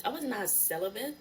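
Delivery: ordinary talk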